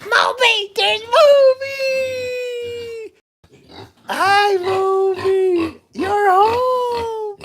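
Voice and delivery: high pet voice welcoming the dog back home; excited pet talk. Real, not acted. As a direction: gush over the dog coming home at the top of the voice. Greeting dog coming home.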